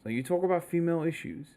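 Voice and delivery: softly imitated, discreet question; hushed retelling of a furtive question; a little bit quietly. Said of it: "a little bit quietly, he goes".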